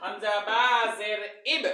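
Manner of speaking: slow, stretched syllables for pupils to repeat; drawn-out spelling drill. Shown as a demonstration. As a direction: spell it out slowly, holding the long vowel. Teacher models spelling for repetition. (টেনে টেনে ধীরে বানান করে পড়া)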